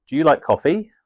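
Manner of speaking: coffee lifted up as a yes or no question; rising question tune. Shown as a demonstration. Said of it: "Rising intonation".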